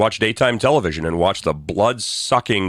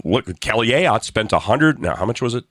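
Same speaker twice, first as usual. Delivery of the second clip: ordinary delivery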